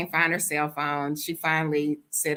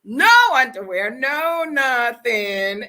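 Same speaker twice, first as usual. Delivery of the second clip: loud, drawn-out disbelief; a loud scandalized drawl; incredulous; 5 semitones higher, 6 dB louder, slower than usual. Retold or imitated. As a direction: stretch it out, scandalized. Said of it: "And I said".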